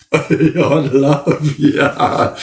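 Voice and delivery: stretched-out words still half laughing; laughing through words. Real, not acted. Laughing as he tells Beck he loves her. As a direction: say it while still giggling.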